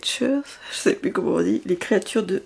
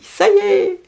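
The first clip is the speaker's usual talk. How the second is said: much higher, jubilant cry; husband's excited news; 5 semitones higher than usual. Retold or imitated. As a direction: burst out like the husband with the good news. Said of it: "mon mari m'a dit".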